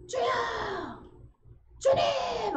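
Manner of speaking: high, loud cry of Lord; urgent call. Shown as a demonstration. Believer crying out to the Lord, loud urgent call.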